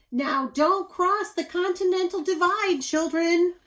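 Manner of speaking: put-on loud, high mother calling to her children. A narrator's reading. Mother calls after her exploring children, loud and high. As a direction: call it across the mountains. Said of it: "Ma Bunyan would call out".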